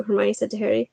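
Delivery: usual speaking voice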